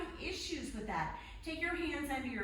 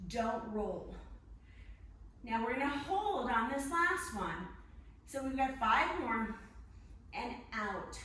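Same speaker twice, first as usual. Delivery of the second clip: ordinary talk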